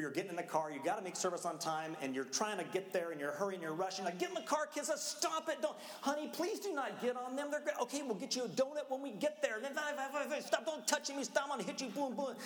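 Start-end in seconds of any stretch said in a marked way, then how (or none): 4.2-12.3 frazzled parent yelling. Retold or imitated. Pastor yelling at his kids in the car, harried shouting. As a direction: scold like a parent at the end of their rope.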